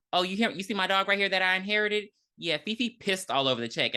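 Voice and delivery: imitating landlady. Telling a tenant about the dog's mess, in a fast mock landlady voice. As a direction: rattle off a silly excuse.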